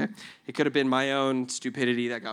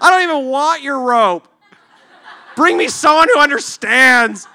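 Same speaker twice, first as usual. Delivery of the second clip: hammed-up high, loud shouting; indignant refusal; 11 semitones higher, 13 dB louder than usual. Trapped man refusing the rope, indignant mock shout. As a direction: reject them in mock outrage. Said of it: "You're like".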